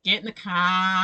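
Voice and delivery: slow, drawn-out Aussie "car"; broad Aussie accent. Shown as a demonstration. Coaching friend in the accent, slow broad Aussie. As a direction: stretch "car" in thick Australian. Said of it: "an accent from my own country".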